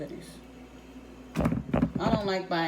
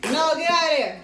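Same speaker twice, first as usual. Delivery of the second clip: louder, higher sharp warning; scolding; 7 semitones higher, 7 dB louder than usual. Real, not acted. Mom scolds child at stove.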